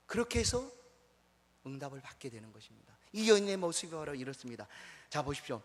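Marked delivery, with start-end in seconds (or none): none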